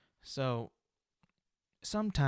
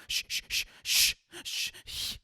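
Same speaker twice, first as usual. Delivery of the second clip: mocking breathy shushes; shushing. Mocking the timid way people shush a cat, breathy shushes. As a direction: hiss lightly as if shooing. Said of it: "I'm not gonna go".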